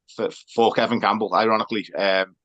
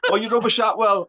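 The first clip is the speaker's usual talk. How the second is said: mock fan heckle; 10 semitones higher than usual.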